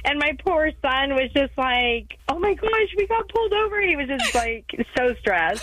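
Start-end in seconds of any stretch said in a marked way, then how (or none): 2.3-3.8 put-on higher, anxious kid voice; worried child in the back seat; imitating her son. Son stressed.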